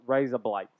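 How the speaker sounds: "raise up lights" run together like Aussie "razor blades"; Australian-sounding wordplay. Shown as a demonstration. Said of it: "it sounds like razor blades in".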